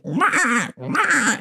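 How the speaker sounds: high penguin squawk; high honking wail; penguin call. Retold or imitated. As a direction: imitate a penguin crying out for its chick. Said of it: "the penguin goes".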